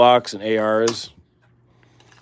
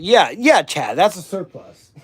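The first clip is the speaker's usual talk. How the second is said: 1 mock answer; 5 semitones higher, 3 dB louder than usual. Mock answer to viewer question.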